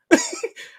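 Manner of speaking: high laugh. Real, not acted. Laughs at own story.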